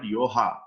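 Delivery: yoha, with h in place of g; southern mispronunciation. Retold or imitated. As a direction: say "yoha" to rhyme with poha. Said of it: "many of us try to call it".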